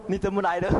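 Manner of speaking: acted surprise at a sudden visitor; startled question. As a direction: blurt it out as if caught off guard. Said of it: "他吓一跳".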